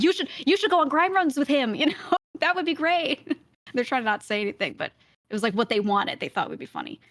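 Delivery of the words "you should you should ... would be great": high-pitched mock-eager urging; playful high voice of excited viewers; imitating eager chat. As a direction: imitate fans nudging someone, pitched well up. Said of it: "but they were like".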